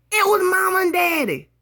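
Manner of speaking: high, loud cry of joy; joyful shout. Retold or imitated. Girl spotting parents' car, shouting with joy. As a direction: burst out at the sight of her parents.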